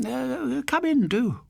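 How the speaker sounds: put-on very high, genteel old woman's voice; lightly voiced fussy old dear; welcoming old lady. A narrator's reading. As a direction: invite him in sweetly.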